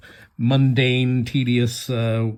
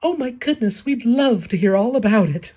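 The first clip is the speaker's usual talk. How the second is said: much higher, gushing delight; eager Batsy; 11 semitones higher than usual. Acted. Batsy gushes to hear the old man's story, much higher. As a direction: squeal with delight. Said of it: "She's like".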